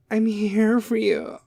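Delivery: high, slow mock-tender voice; Kim K. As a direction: drag out fake celebrity comfort. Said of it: "is going to be all Kim K".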